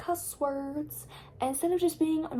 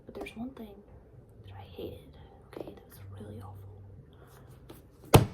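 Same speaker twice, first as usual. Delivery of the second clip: hushed, secretive aside; dramatic whisper; slower than usual. Real, not acted. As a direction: whisper it like a confession. Confessing what she hated.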